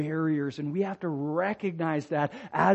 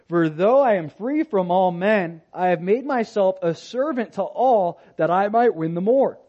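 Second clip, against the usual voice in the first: louder, measured reading of the verses; ringing scripture recital; 3 semitones higher, 4 dB louder than usual. Acted. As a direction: proclaim the passage to the congregation. Reads First Corinthians aloud.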